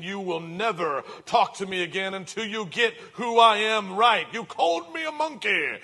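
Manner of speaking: mock furious father. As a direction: ham up an outraged dad.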